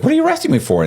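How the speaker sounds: high-pitched indignant question; retold baffled protest.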